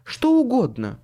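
high, forceful woman's voice; exasperated female insistence; sighing woman. A narrator's reading. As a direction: throw it out with a sigh. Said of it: "вздохнула женщина".

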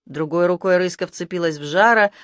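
ordinary delivery

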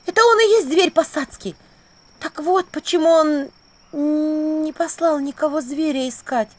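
high, loud and slowing as it sinks in; astonished realisation. A narrator's reading. Vasilisa working out who the beast is, shocked realisation. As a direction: piece it together aloud in drawn-out amazement.

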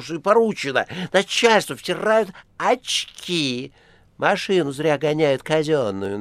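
high, loud whiny tattletale voice; sneaky tattling cat. A narrator's reading. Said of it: "наябедничал кот". (ябедничающий кот)

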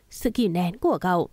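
plain reading voice

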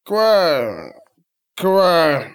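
drawn-out imitation of croaking; tipsy frog impression, falling in pitch. A narrator's reading. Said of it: "издал он подобие лягушачьего кваканья".

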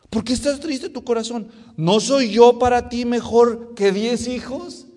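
loud, high pleading question; imitating a clueless husband's appeal; hammed-up husband. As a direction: plead loudly, drawing the question out. Acts out the husband's tactless question.

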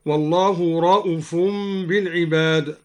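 "رؤوف" said ra'ufun with a short u; no long vowel after the hamza of "رؤوف"; shortened hamza. Shown as a demonstration. Teacher shows al-Duri's reading, "رؤوف" as short ra'ufun. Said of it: "وقصر الهمزة من قوله تعالى".

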